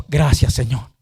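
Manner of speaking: lightly voiced beggar, pitched low; low-pitched acted prayer of thanks; grateful beggar praying. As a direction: give thanks to God as the humble beggar. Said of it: "Decía".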